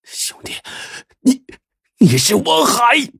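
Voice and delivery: whispered, then a high stunned cry; shocked realization. Acted. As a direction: stammer it in disbelief. Dying man recognizes his brother.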